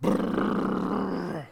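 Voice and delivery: imitated truck rumble with a long trill; truck engine noise. Preacher imitating the new truck you heard, long rolled brrr. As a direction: rev like a big truck through the lips. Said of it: "Until you heard".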